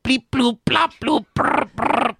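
very high silly bleeping noises; robot beeps. Retold or imitated. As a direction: squeak out nonsense beeps like a robot. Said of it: "get Tom Cruise to say".

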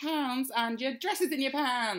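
high, loud, slow game-show reveal; hammed-up showbiz host shouting the good news; mock prize announcer.

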